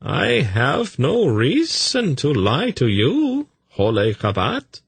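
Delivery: high, slow, swooping protest; protesting messenger. A narrator's reading. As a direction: deny it with elaborate deference. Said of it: "he protested".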